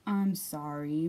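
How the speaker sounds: low, slow apology; mumbling Mole. A narrator's reading. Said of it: "mumbled Mole".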